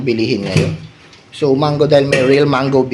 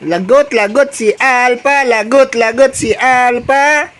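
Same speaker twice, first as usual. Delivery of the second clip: playful warning; 8 semitones higher, slower than usual. Real, not acted.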